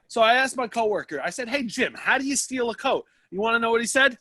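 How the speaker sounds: lightly voiced naive coat checker; comic naive storyteller. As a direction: play the wide-eyed innocent. Comic story keeps raised voice.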